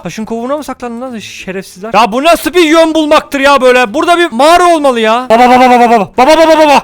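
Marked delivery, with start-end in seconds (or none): 5.3-6.8 loud repeated calling; shouting. Real, not acted. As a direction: call out loudly over and over. Yelling at a running figure.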